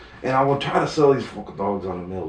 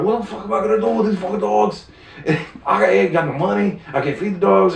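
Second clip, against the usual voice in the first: retold panic; 8 semitones higher, 4 dB louder, faster than usual. Inner panic over the dogs.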